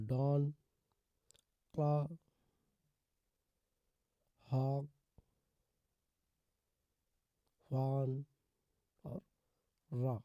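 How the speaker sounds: "डॉन, क्लॉ, हॉक, फॉन, रॉ" one by one with open aw; measured word list, open aw in "हॉक" and "फॉन"; AW pronunciation. Shown as a demonstration. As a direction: sound out each word, "डॉन" to "रॉ", stressing the aw vowel. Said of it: "ए डब्ल्यू का जो उच्चारण".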